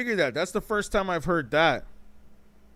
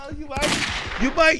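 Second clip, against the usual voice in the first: laughing; 11 semitones higher, slower than usual. Retold or imitated. Mocking the commercial, laughing.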